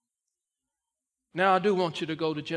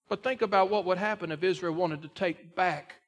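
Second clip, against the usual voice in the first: ordinary talk, nothing marked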